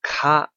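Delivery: 卡 said short, loud and high; demo syllable. Shown as a demonstration. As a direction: snap out 卡 as an example. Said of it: "这个".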